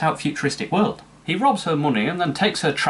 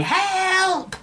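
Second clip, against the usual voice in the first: high shrieked cry for help; mock scream. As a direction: shriek it like a panicked victim. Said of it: "or for her screaming".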